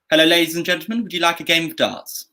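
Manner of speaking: standard RP English accent; crisp Received Pronunciation greeting to a crowd. Shown as a demonstration. Games host inviting players on request, in an RP accent. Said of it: "a standard R P, uh, accent".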